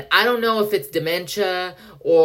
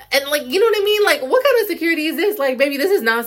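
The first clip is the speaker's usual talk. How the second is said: high-pitched outrage; 10 semitones higher, 4 dB louder, faster than usual. Real, not acted. Ranting at the store check.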